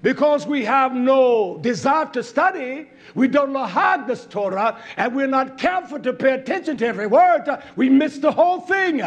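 forceful pulpit teaching; emphatic preaching. Real, not acted. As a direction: drive each phrase home like a preacher.

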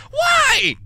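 exasperated outcry. Real, not acted. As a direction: cry out at the unfairness of it. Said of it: "You frickin' went on a tirade".

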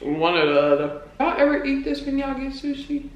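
chewing between words; eating while talking. Real, not acted. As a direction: mouth partly full. Seen 2: holds ginger on chopsticks at mouth and nose, seemingly chewing.

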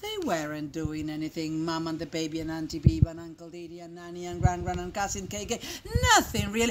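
low, soft, rapid murmur; quick, hushed, suspenseful narration; hushed refrain. A narrator's reading. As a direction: rattle off the list quietly on a low note. Same refrain hushed before last arrival.

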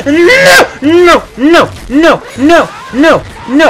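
high, loud desperate screams; panicked screaming. Real, not acted. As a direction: scream no over and over as you die. Dying in game, panicked.

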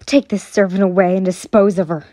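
brisk, firm command; stern order. A narrator's reading. Mistress having a servant taken away, stern brisk order. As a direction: order it coldly and fast. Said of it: "Hano-sama ordered".

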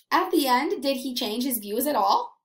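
plain reading voice

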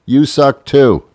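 put-on high, loud childish retort; robot snapping back like a kid; robot comeback. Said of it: "the robot responds".

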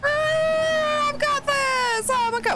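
high, excited yelp of victory; giddy high cry of triumph; triumphant squeal. Real, not acted. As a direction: shriek like you just nailed it. Triumphant moment in game.

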